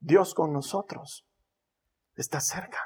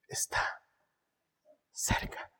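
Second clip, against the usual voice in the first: whisper; 10 dB quieter, slower than usual. Shown as a demonstration. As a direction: drop into a whisper. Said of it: "No necesita gritar".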